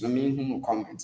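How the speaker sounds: ordinary delivery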